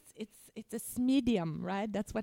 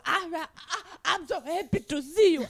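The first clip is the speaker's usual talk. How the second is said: excited dog voice; 4 semitones higher, 5 dB louder than usual. Pretend. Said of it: "I want to go".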